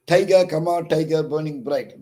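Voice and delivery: TI-ger stressed high and loud, trochee beat; stressed meter reading. Shown as a demonstration. Teacher scanning the meter for students, stressed and loud.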